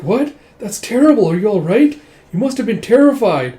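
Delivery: high, loud, alarmed concern for her; worried gasp. Retold or imitated. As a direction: rush to her side in alarm. Il worried about injured girl.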